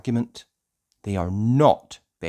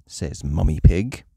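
usual speaking voice

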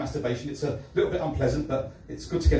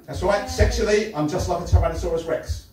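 a hint of a cocky lounge lothario, higher; preening self-introduction, a touch higher; smug showman persona; 7 semitones higher than usual. Stand-up character intro.

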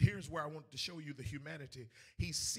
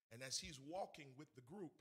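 ordinary talk

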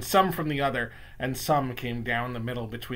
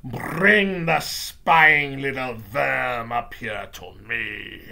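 slow, loud menacing witch voice; commanding villainess, fading at the end; Grand High Witch; 6 dB louder, much slower than usual. Acted. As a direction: drag the words out with contempt. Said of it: "shouted the Grand High Witch".

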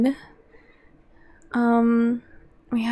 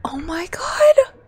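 awed whisper; 6 semitones higher, slower than usual. Real, not acted. Stunned at the curse.

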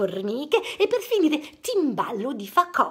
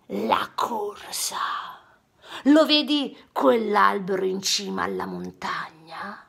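whispered lion master; 5 semitones lower than usual. Acted. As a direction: whisper like a wise old master. Master teaching.

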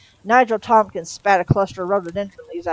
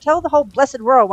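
loud, raised sarcastic outburst; irritated snap; 7 semitones higher, 8 dB louder than usual. A narrator's reading. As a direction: snap back high and exasperated. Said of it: "Crowley snapped".

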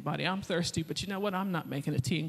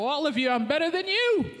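louder, higher mock boast; gloating; 9 semitones higher, 8 dB louder than usual. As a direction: crow about being better, hammed-up. Admitting his envious motive.

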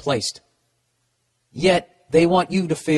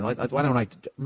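stumbling, stuttering over words; 6 semitones lower, 7 dB quieter than usual. Real, not acted. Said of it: "I'm stuttering here".

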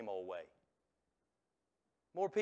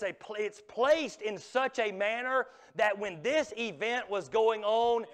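loud, driving revival preaching; 6 semitones higher, 10 dB louder than usual. Real, not acted. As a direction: drive the scene home to the congregation. Preaches Elijah's story at full force.

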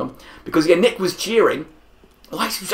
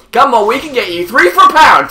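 higher, louder barker call; fairground barker; 5 semitones higher, 6 dB louder than usual. Pretend. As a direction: hawk it like a coconut-shy stall keeper. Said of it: "This is some sort of fairground attraction".

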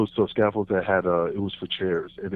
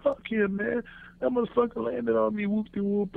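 much higher, griping voice; mock ranting; 13 semitones higher than usual. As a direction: vent to Paul. And Paul's like.

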